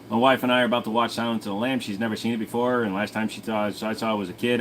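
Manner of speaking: normal talking voice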